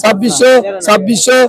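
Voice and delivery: steady rhythmic bid calling; auctioneer. Real, not acted. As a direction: chant the rising prices like a market auctioneer. Calling bids at fish auction.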